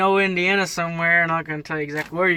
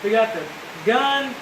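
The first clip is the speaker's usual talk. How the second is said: screaming over rain; 7 semitones higher than usual. Real, not acted. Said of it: "It's probably why I'm screaming".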